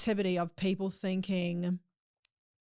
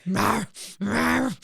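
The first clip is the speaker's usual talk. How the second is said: mock growl; 3 semitones higher, 9 dB louder than usual. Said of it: "angry with him for two days".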